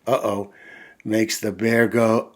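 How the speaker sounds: ordinary delivery, nothing marked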